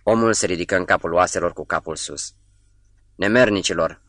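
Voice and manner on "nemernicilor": usual speaking voice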